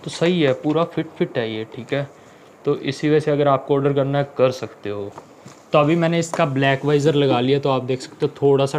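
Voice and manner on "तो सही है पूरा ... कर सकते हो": muffled behind chin guard; speaking through helmet; inside a helmet. Real, not acted. Seen 1: Helmet worn throughout with the visor up and the chin bar covering the mouth.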